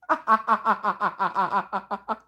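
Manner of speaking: high, loud burst of laughter. Real, not acted.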